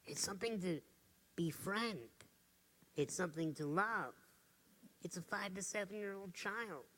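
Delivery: quiet and gentle; soft tender. Real, not acted. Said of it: "tender about inner voice".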